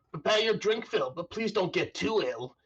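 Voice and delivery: high-pitched bartender's announcement; calling over the crowd. Acted. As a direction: sing out a toast to a packed room. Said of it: "he says over the crowd".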